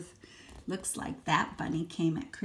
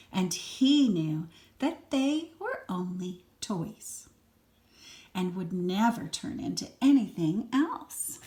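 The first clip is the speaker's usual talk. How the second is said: put-on lively read-aloud for a small child; expressive storybook reading; story narration; 4 semitones higher, 6 dB louder than usual. A narrator's reading. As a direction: read it like a bedtime picture book. Reads picture book to child.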